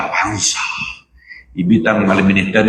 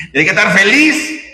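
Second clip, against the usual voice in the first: higher, teasing repeat; mock cheer; 11 semitones higher, 3 dB louder than usual. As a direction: poke fun at forced party joy. Se burla de la fiesta. (tono burlón y agudo)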